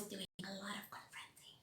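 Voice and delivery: soft unvoiced confession; whisper. Real, not acted. Admitting her confrontational past, whispered. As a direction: whisper it like a secret.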